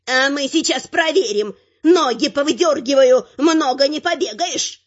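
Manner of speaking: high-pitched she-troll threat; growling troll woman. A narrator's reading. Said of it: "рычит троллиха".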